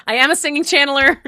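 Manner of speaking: high, loud and quick; loud proclamation. Real, not acted. Host jokes after the improvised song, loud high proclamation. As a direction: belt it out playfully.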